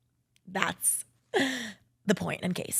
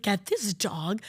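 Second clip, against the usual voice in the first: mock annoyed roommate; 2 semitones higher, slower than usual. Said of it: "But Emily's like".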